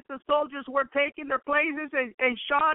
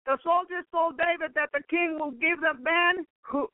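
normal talking voice

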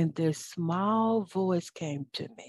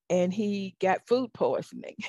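ordinary talk, nothing marked

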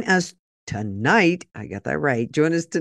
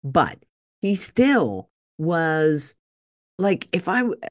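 ordinary delivery

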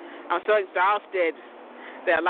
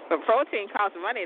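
ordinary delivery

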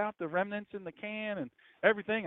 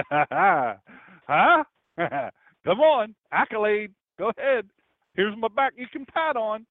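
louder, higher, fishing for praise; proud mock husband; 4 semitones higher, 7 dB louder, slower than usual. Husband bragging to his wife about his soup, loud and proud. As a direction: swagger in for a pat on the back.

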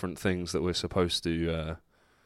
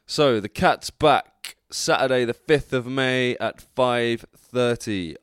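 slow, drawn out, louder announcement; slow dramatic announcement; 3 semitones higher, 4 dB louder, slower than usual. Acted. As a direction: announce it slowly like a trailer.

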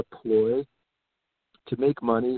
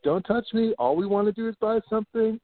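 higher, offended protest; indignant shopper; 8 semitones higher than usual. Retold or imitated. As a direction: whine it like a wronged customer.

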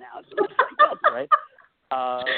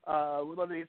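ordinary delivery

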